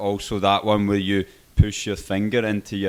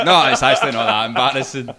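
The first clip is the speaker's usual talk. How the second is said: louder, talking through laughter; laughing denial; 2 semitones higher, 8 dB louder than usual. Real, not acted. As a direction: protest while cracking up. Laughing at friend's embarrassing guess.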